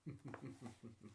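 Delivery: a quiet, breathy villain's chuckle; soft evil laugh. A narrator's reading. Headmaster turning away from the teacher, soft evil chuckle. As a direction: laugh softly to yourself, evilly. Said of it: "he laughed, softly and evilly".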